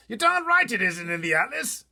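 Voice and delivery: blustering officer exploding; shouting Air Force chief. Acted. Air Force head shouting at the pilot, outraged. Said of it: "cried the head of the Air Force".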